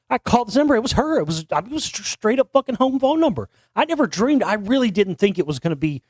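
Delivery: high-pitched disbelief, real excitement; amazed excitement. Real, not acted. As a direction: blurt it out, still astonished. Amazed retelling of who answered.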